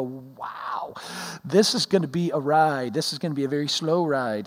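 hushed, low-pitched mock awe; daunted reaction. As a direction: sink into quiet, retold dismay. His own daunted reaction, retold.

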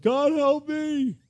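desperate, drawn-out cry for help; yelled prayer. Retold or imitated. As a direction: howl it up to heaven, alone in the car. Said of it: "he yelled to the Lord".

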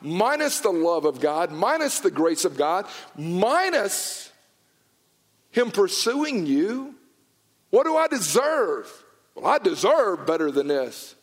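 pleading sermon pitched high and strong; fervent preaching. Acted. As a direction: preach it with building fire. Preaching, building passion.

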